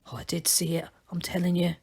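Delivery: hushed and low, grumbling to himself; whispered. A narrator's reading. Torret trailing behind the others, grumbling, whispering. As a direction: whisper it stubbornly under the breath. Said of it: "he whispered".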